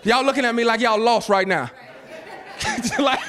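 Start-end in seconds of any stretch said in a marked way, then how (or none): 1.8-3.3 laughing. Real, not acted. Laughing at the lost congregation.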